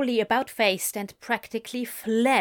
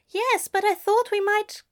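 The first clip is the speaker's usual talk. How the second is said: higher, fussy, pleading bookseller; high Aziraphale; 12 semitones higher, 5 dB louder than usual. Acted. Aziraphale calls after fleeing Crowley, high and plaintive. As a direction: call after him, a little plaintive.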